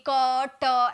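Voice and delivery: "क ट" broken into two drawn-out sounds; wrong split syllable. Shown as a demonstration. Teacher showing how one syllable is not said, split in two. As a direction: say "क ... ट" as two separate beats. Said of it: "ऐसे थोड़ी बोल रहा है".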